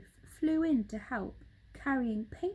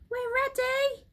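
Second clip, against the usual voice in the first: high, loud eager monkeys; 5 dB louder than usual. Acted. Young monkeys answering their father, high eager. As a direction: chirp it keenly. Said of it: "said the monkeys".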